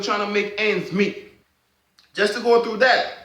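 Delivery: high, loud, fired-up defence; rally the listeners with rising passion; a real heated plea, pitch way up; impassioned. Real, not acted. Impassioned defence of sickle cell warriors.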